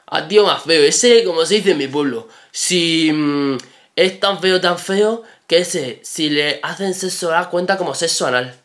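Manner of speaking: mock the instructor's strange rustic talk; very odd speech. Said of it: "es que hablaba súper raro".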